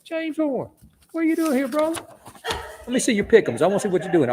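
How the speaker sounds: high and playful, needling a pal; teasing buddy. Retold or imitated. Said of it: "you gonna tell me".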